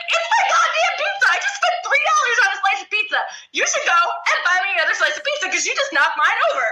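high mock rant, laughing through it; a teen demanding a new slice, half-laughing mock scream; retold screaming. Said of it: "the fat chick screaming about my slice".

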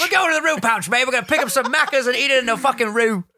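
high, fast, hammed-up Aussie accent; excited amateur Australian accent. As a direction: rattle it off as a mock Australian. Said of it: "do a good Australian accent".

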